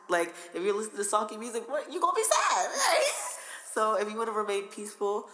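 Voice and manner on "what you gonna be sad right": laughing, rising high and loud; cracking up mid-sentence. Real, not acted. Laughing to the host about sad music.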